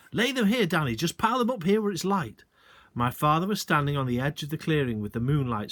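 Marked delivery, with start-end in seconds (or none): 0.1-2.4 calling back. A narrator's reading. Father calls back.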